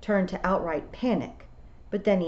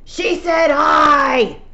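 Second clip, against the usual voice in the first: loud, high, drawn-out shout; 11 semitones higher, 13 dB louder, much slower than usual. A narrator's reading. Rob Anybody shouting down Fionn's objection. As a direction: bellow it to the whole clan. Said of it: "Rob Anybody shouted".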